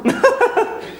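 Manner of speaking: high-pitched stage laugh; high, gleeful cackle. Acted. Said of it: "Jetzt lacht er".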